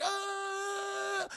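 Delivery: high shouted aah; hammed-up falling scream. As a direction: scream as you drop. Everyone falls screaming.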